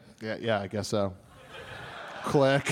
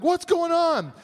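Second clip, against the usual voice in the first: startled high squeak; 12 semitones higher, slower than usual. Retold or imitated. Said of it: "I was like".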